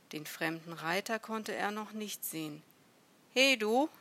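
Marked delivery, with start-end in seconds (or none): 3.3-3.9 loud, higher-pitched hail; sharp call across a distance. A narrator's reading. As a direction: shout to catch a stranger's attention. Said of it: "rief er auf Syrisch".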